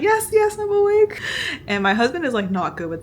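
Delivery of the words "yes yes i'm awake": high, loud, eager yes yes; eager reassurance. Retold or imitated. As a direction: quickly insist you're awake, voice jumping up. Assuring anesthesiologist she's awake.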